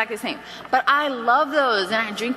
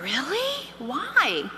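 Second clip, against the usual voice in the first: softer, high rising query; mock puzzlement; 4 semitones higher, 4 dB quieter, much slower than usual. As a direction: feign innocent surprise, sliding up then down. Director acts surprise at complaint.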